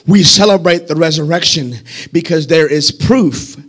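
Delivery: hushed preaching. Real, not acted. Preacher quietly explaining the proof of resurrection, hushed and low. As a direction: pull right back and draw the listeners in. Sudden drop after climax.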